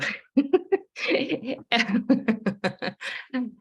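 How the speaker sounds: high, breathy nervous giggle; a real nervous laugh before speaking. Real, not acted. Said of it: "this is me laughing nervously".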